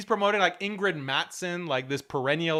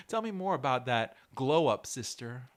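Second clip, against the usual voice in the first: mock flirting; 5 semitones lower, 4 dB quieter than usual.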